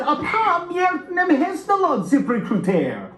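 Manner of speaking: high voice in Hungarian; Hungarian son voice. Acted. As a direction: squeak it out in Hungarian. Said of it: "and said".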